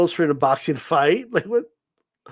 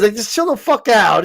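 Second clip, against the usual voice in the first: normal talking voice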